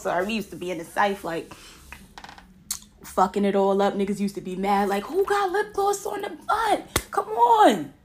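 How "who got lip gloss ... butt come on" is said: high, slow mocking voice; teasing schoolmate voice; mocking guy. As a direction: jeer it in a high put-on voice. Guys mocking them at school.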